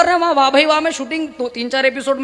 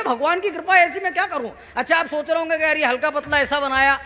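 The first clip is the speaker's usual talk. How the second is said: usual speaking voice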